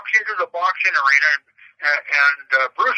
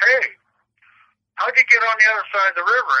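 higher, quick calling out; mock yell; 7 semitones higher, faster than usual. One blonde calls over the river to another, mock yell. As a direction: lightly imitate a yell across a distance. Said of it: "she yells over at the blonde".

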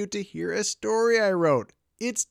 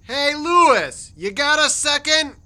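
higher call to a companion; 5 semitones higher than usual. Acted. Clark hails his companion from inside the tent, calling out. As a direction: call over to someone outside. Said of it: "Clark called from inside their tent".

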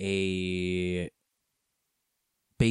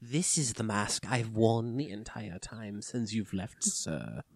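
hushed dramatic; 2 semitones lower, 10 dB quieter than usual. Acted. Hushed dramatic reveal bit.

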